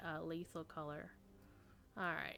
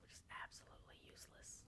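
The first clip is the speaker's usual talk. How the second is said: whisper; 19 dB quieter, much faster than usual. Real, not acted. Whispered aside about a useless axe.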